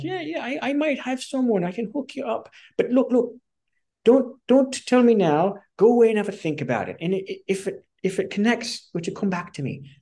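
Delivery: very high-pitched Irish impersonation; Irish mentor. Retold or imitated. Mentor offering to hook up a friend, imitated Irish accent. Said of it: "he's Irish".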